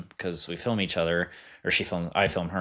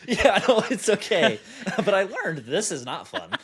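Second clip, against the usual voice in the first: kind gentle laugh; 11 semitones higher than usual. Real, not acted. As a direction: laugh gently while answering. Laughs after drunk gym story.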